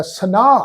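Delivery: ਸਨਾ with the ਹ merged into a long aa; correct pronunciation. Shown as a demonstration. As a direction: draw out the final aa. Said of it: "ਇੱਦਾਂ ਪਾਠ ਆਏਗਾ".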